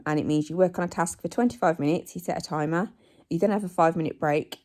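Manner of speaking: usual speaking voice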